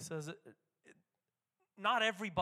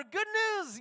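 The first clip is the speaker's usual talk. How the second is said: shouted high and loud; a lookout crying tidings from the wall; watchman's announcement; 12 dB louder than usual. Acted. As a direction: call it out like a town herald. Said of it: "they would announce this stuff".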